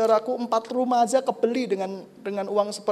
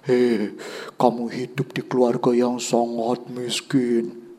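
slow, lower devil voice; taunting devil; 7 semitones lower, 4 dB louder, slower than usual. Acted. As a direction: drag out the taunt like a tempter. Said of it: "iblis kalau mulai bilang".

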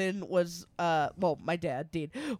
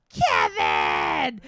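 calling out; 4 semitones higher, 7 dB louder than usual. Retold or imitated. Mom calling out nurse name.

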